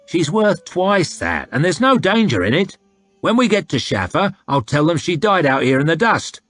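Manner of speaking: high, loud haggling soldier; coarse slaver. Acted. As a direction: push the sale like a crude trader. Soldier voice persuading.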